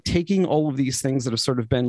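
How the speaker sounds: ordinary delivery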